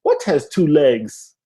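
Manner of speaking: put-on slow riddle in a thick Haitian accent; Haitian Riddler mom. Mom quizzing her son, slow Haitian accent. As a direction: pose it as a Haitian-accented mother, drawn out. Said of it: "like the Haitian Riddler".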